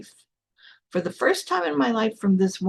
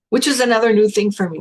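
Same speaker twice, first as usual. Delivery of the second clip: ordinary talk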